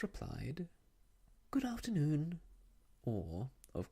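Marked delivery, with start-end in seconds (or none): 1.5-2.4 put-on children replying. A narrator's reading. Children's dutiful reply, voiced.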